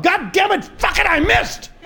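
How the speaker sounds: high yelled curse at the missed shot; angry shout. Acted. Golfer shouting at his missed swing in fury. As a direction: yell it, furious at the ball.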